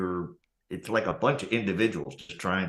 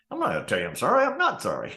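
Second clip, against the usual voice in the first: higher, rising to a much higher defiant sneer; defiant refusal; 10 semitones higher, faster than usual. Pretend. Imagined Londoner refusing to apologise, mock defiant. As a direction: play the rude city person. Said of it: "it's like".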